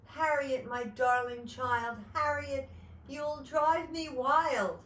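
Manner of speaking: high, loud, drawn-out exasperation; exasperated mother. A narrator's reading. Mother to child who dripped paint, loudly exasperated. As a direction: stretch it out, just short of yelling. Mother's patience wearing thin.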